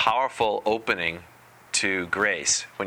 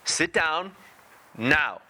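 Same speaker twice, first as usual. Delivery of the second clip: high-pitched toddler command, lightly acted; two-year-old impersonation; 9 semitones higher, much slower than usual. Toddler ordering a parent to sit, high mock command. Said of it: "my best two-year-old impersonation".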